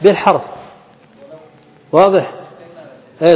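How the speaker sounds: ordinary delivery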